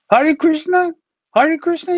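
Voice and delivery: put-on very high, delighted old lama; lama excited to hear the mantra; Rinpoche's voice.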